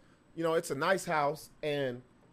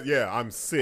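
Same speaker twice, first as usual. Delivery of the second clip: lightly mocked corny introduction, pitched down; corny self-introduction; 6 semitones lower, slower than usual. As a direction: imitate a cheesy guy introducing himself, low. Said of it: "He does it all corny".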